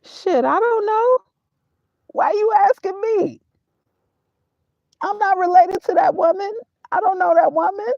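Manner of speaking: very high-pitched incredulous squeal; exasperated protest. Real, not acted. Speaker disowning a woman he's asked about, exasperated.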